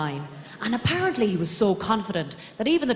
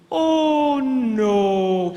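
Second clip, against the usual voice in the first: higher, drawn-out "oh no" of false pity; mock dismay; 4 semitones higher, 4 dB louder than usual. Comedian reacting to sad tabloid stories, mock dismay. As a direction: draw it out in mock horror.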